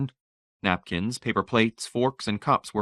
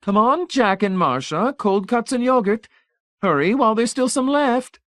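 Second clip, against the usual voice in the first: high, loud sing-song call; 5 dB louder than usual. A narrator's reading. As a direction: Mrs Pritchett calling them to eat. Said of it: "Missus Pritchett called".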